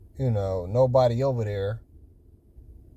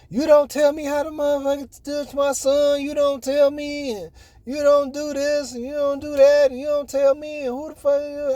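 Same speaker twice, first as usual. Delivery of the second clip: high, raging retold tirade, far above his usual pitch; crazy angry rant; 12 semitones higher than usual. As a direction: imitate a mother going off on a stranger. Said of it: "this lady went crazy".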